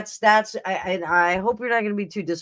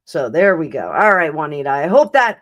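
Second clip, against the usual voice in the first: normal talking voice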